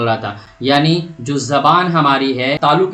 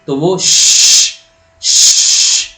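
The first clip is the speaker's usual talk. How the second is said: long pressure-cooker sh hiss; drawn-out loud sh, no voice at the end; hissing sh sound; 4 dB louder, much slower than usual. Shown as a demonstration. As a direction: let the sh hiss out like a whistle. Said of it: "اس طرح کی آواز آئے گی".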